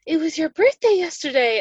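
bright mock dentist voice; imitating the dentist. Quoting the kind dentist noticing her birthday, light imitation. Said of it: "And she was like".

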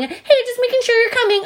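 put-on squeaky high phone voice of the store girl; high-pitched voice of the mall employee on the phone; high-pitched sales girl. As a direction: mimic the sweet high voice of the saleswoman. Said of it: "with, like, a high-pitched voice".

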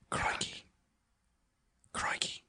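Crikey in a breathy Australian whisper; testing whether a whispered Australian accent survives. Shown as a demonstration. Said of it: "I was talking in".

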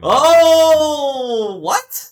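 a loud, high gasp of shock; real jolt of surprise; excited. Real, not acted. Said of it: "I got excited".

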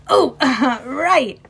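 hasty chiming in. A narrator's reading. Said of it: "he chimed in".

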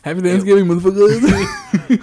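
slow, loud, laughing holiday shout; loud greeting. Pretend. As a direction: belt out a cheeky greeting.

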